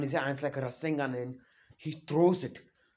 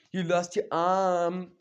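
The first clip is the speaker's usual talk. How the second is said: slow, stretched-out gloat; lightly hammed-up maniac taunt, drawn out; gloating maniac villain; 3 semitones higher, 3 dB louder, much slower than usual. Madara gloating over Naruto's cut arm, slow maniac taunt. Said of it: "he's laughing like a maniac".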